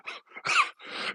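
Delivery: high, breaking laugh that turns to a cough. Real, not acted. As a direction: laugh until it catches in the throat. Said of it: "I was laughing at it".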